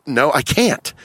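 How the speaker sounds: ordinary talk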